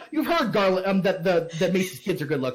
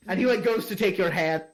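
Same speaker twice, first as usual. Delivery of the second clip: ordinary delivery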